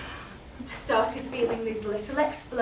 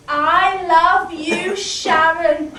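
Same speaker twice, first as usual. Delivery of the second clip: loud declaration of love; 4 semitones higher, 11 dB louder, much slower than usual. Acted. Reading graffiti dramatically.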